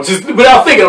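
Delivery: usual speaking voice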